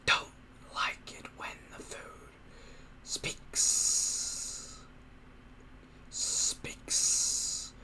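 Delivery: soft whining monster whisper; a creature whispering and whining, annoyed. Acted. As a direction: whine it to yourself like a sulky beast. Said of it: "whined the creature to itself".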